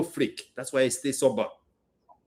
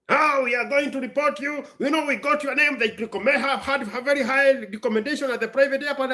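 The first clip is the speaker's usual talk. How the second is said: higher, mock-threatening father-in-law voice; imitating father-in-law; 8 semitones higher than usual. Father-in-law calls the speaker threatening to report her, mock-threatening. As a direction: lightly ham up the in-law's threat. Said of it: "the father-in-law picks up the phone".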